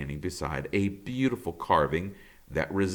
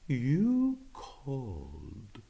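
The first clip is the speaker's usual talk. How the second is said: soft, very slow, lilting voice; a suave bird gliding in; musical woodpecker; 4 semitones higher, 6 dB quieter, much slower than usual. Acted. The handsome woodpecker answering the beaver, soft and slow. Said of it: "the woodpecker's musical voice".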